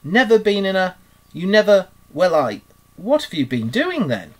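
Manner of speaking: high, loud, incredulous outburst; astonished, starting very high and easing down; open-mouthed astonishment. A narrator's reading. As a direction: splutter it in disbelief. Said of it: "open-mouthed".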